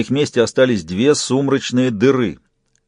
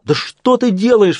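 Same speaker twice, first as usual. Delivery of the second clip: crying out; 10 semitones higher than usual. A narrator's reading. Jack to the puppet taking him over, crying out. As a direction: cry it out in a jump of pitch. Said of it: "вскричал Джек".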